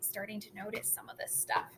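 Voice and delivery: whisper. Real, not acted. Teacher trails into an aside.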